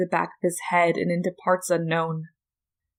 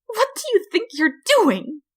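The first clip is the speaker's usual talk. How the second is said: much higher, louder flower voice; alarmed Flowey; 14 semitones higher, 4 dB louder than usual. A narrator's reading. Flower being carried off, high and alarmed. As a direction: squeal it in alarm.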